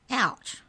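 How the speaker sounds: quick high "Ouch"; yelp. Acted. Trent pinches himself and yelps in surprise. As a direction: yelp at his own pinch. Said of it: "he yelped".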